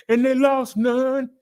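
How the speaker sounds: high, loud and slow, crowing it; triumphant catchphrase. Acted. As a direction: sing out the good news like a victory call. Catchphrase on zero deaths.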